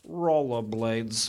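low, very slow fake Russian; mock Russian accent. Shown as a demonstration. Comic demonstrating Russian to the crowd, low slow mock Russian accent. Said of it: "how I say penis in Russian".

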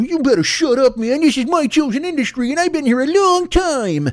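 put-on high old New York tough-guy voice; Bowery Boys voice. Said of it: "I sound like one of the Bowery Boys".

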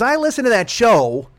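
high, loud, drawn-out mom voice; mock accusing mother. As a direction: imitate a mother dragging out an accusation. Mother accusing son, acted.

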